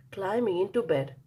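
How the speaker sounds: usual speaking voice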